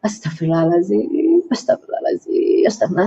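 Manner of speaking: low murmured prayer, fading to a whisper; quiet repeated istighfar, voice sinking; soft istighfar. Shown as a demonstration. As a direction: pray it under your breath. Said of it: "diajarkan ... untuk istighfar".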